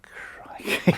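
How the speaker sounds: hushed, breathy crikey; retold teammate, whispering in shock; whispered exclamation. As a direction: gasp it under your breath. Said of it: "looking over Tony Haley going".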